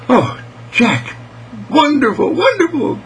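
put-on high, gushing girl's voice; delighted little girl. Mary Lee praises Jack's discovery, high and delighted. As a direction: exclaim in girlish wonder, pitched very high.

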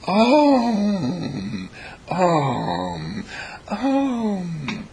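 dreamy Om. Acted. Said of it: "And she says".

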